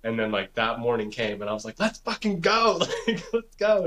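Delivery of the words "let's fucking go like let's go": high-pitched pumped-up rally cry; hyped up. Retold or imitated. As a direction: replay psyching yourself up, pitch way up. Retold hyped-up battle cry.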